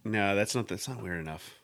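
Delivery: ordinary talk, nothing marked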